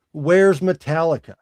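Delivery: normal talking voice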